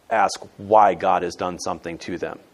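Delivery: normal talking voice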